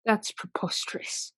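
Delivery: scornful hushed denial. Acted. Accused lady scoffs at charge.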